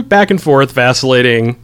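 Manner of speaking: normal talking voice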